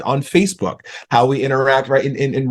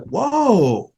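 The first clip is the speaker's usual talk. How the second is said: surprised whoa; 7 semitones higher than usual. Retold or imitated. Surprised reaction in retold talk.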